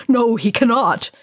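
wild. A narrator's reading. Dwarf leader agreeing the wizard will fail, wildly. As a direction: blurt it out in desperation. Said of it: "Thorin said wildly".

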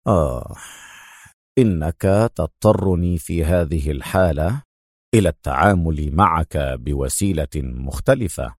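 low, calm and resigned; sigh, then speak calmly and deeply. A narrator's reading. Adham warning his rival of other means, calm. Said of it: "تنهد أدهم وقال في هدوء".

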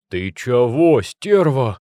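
loud strangled outburst; strained wheeze. Acted. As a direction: choke it out in pain. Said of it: "прохрипел сотрапезник".

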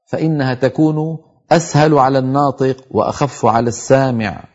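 ordinary talk, nothing marked

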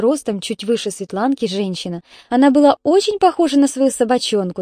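ordinary delivery, nothing marked

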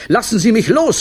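high, loud cry, rising at the end; screaming. A narrator's reading. Clown held up by the director, high scream. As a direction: cry out to be released. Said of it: "schrie Olivio".

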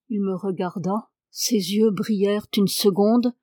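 plain reading voice

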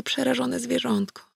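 ordinary delivery

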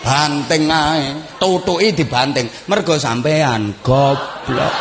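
drawn-out words at half speed; slow mocking scold. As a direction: stretch the insult out lazily. Same scolding, drawn out slowly. (omelan mengejek pelan dan diulur)

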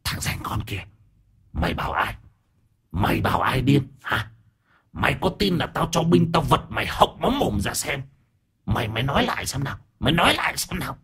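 soft, raspy menace climbing high; indignant, strained spirit voice; seething possessed aunt. A narrator's reading. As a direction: hiss threats as an outraged spirit. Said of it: "nhìn thằng cháu mà căm phẫn".

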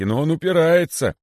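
high, loud complaint; complaining guards. A narrator's reading. Guards excuse themselves to the python, complaining. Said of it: "отвечали стражники".

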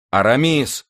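high, mild shout; shouted call. A narrator's reading. Athos calls to Aramis mid-fight, shouted call. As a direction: call the name out urgently. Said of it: "крикнул Атос".

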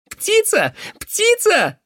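very high crow-like cries; bird-boy shout. Acted. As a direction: shout back in a high caw. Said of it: "крикнул тот в ответ". (высокий птичий крик)

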